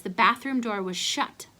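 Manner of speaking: ordinary delivery, nothing marked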